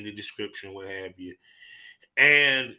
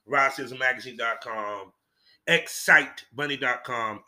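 ordinary delivery

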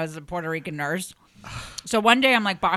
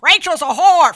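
imitating a loud, higher yell; retold scream; 11 semitones higher, 13 dB louder, slower than usual. Retelling Keith yelling across the gym, imitating a loud scream. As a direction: belt it out like the coach across the gym. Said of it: "Keith just kept screaming".

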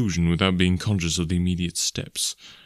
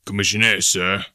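gruff; 2 semitones lower than usual. A narrator's reading. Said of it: "he said gruffly".

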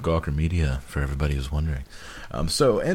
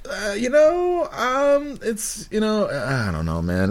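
mock clueless high voice; slower than usual. Suggesting his friend play Capote as a clueless dude, high and dopey. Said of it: "played it like you".

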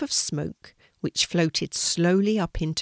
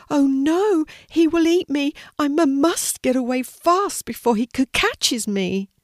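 much higher, louder stammering panic; panicked fear; 9 semitones higher, 6 dB louder than usual. A narrator's reading. Sea turtle backing away from the dragon, panicking. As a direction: cry out as if about to be caught. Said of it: "backing up against a rock in fear".

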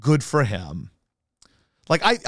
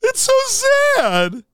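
much higher, louder, slow mock wail; mocking Mike Missanelli crying; 7 dB louder, slower than usual. Host mocks Missanelli blubbering over getting fired, high slow wail. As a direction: ham up a crybaby whine, stretching the words. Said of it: "Hey, I'm Mike Missanelli".

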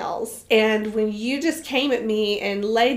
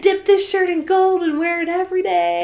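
higher, playful gushing; giddy boast; 7 semitones higher, 3 dB louder than usual. Retold or imitated. Hiker gushing about the shirt, giddy and higher. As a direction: gush over the prize shirt. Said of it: "I was like".

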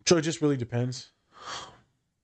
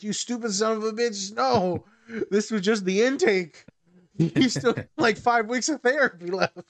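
exasperated yell; 8 semitones higher than usual. Pretend. Therapist berating client.

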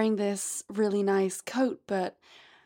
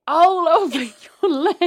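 mock shout; 7 semitones higher, 10 dB louder than usual. Role play climax.

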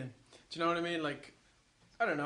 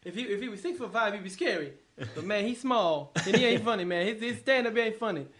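animated, higher celebrity impression; Eddie Murphy; 4 semitones higher, 4 dB louder than usual. Star mocking a rival's size, animated high impression. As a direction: riff insistently as the star comic. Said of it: "Can Eddie Murphy do an impression".

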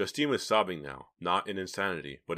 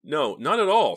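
sharp raised protest; loud denial; 10 semitones higher, 11 dB louder than usual. A narrator's reading. Philip protesting to the teens, loud. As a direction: burst out in denial.

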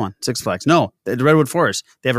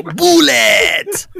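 put-on very high, loud Persian-accented cry; 5 dB louder than usual. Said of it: "He'd just go".

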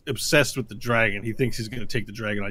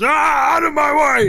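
much higher, loud furious bark; roaring brute clearing the path; monster shout; 11 dB louder than usual. Acted. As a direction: shove past them yelling. Bugbear shoves player aside.

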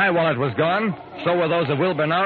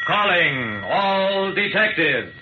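slow, drawn-out title call; slow, grand radio title read; dramatic title announcement; 4 semitones higher, much slower than usual. Acted. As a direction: announce the show name with weight.

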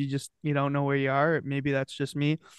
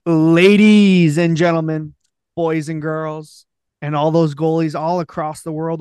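grand entrance announcement; 9 dB louder, slower than usual. Acted. Podcast intro announcement.

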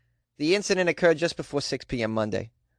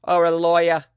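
lawyer in a thick Boston accent; 4 dB louder than usual. Shown as a demonstration. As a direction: drop the r like a Bostonian. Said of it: "words that sound great with a Boston accent".

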